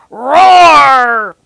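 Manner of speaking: huge loud monster roar; a roaring giant creature; big roar. Acted. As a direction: roar it out to scare the kids.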